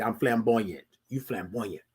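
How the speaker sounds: half-hearted imitation of the critic's snub; mocking their tone. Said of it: "that's how they said it to me".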